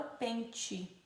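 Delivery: "pente" ending in soft "chee"; Northeastern tch pronunciation. Shown as a demonstration. Teacher showing Northeast Brazil's way, "pente" with tch. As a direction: say "pente" as PEN-tchee, softly. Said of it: "no Nordeste do Brasil".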